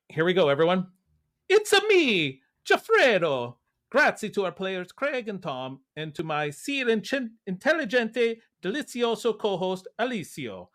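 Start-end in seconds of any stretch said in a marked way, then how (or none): 1.5-10.7 put-on Mario Italian accent. Host reading co-host's outro to listeners in a hammy Mario Italian accent. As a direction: ham up a video-game Italian plumber accent. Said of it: "give me, like, your best Mario".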